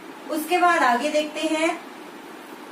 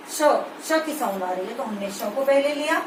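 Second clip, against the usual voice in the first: ordinary talk, nothing marked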